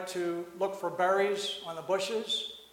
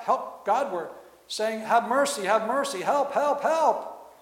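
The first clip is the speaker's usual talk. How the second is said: rising, louder and higher cries of help; shouting for help; 4 semitones higher, 5 dB louder, slower than usual. Retold or imitated. A preacher voices the monk shouting help Godward, climbing higher. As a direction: build each help into a desperate shout. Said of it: "when he did not shout".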